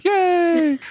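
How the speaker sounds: high squealing cheer; big yay. Acted. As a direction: cheer like a delighted kid. Cheering pattern in email.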